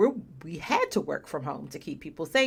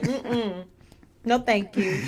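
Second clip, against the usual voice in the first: sassy refusal; 5 semitones higher, 5 dB louder, slower than usual. Retold or imitated. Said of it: "They're saying".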